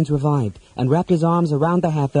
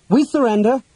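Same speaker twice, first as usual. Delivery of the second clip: high, raised call of surrender; 9 semitones higher, 3 dB louder than usual. A narrator's reading. Half-elf to the captor in the doorway, calling out. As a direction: call it out across the room. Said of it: "the half-elf called out".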